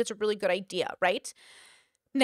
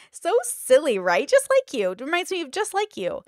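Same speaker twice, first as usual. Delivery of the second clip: high, loud mock teasing; mocking tease; 4 semitones higher, 6 dB louder than usual. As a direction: imitate a friend gleefully rubbing it in. Acting Susan mocking the listener.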